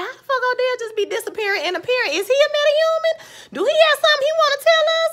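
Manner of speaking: mock-outraged rant, pitch climbing; incredulous outburst. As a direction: fire off the questions in shrill disbelief. Exasperated at a character vanishing again.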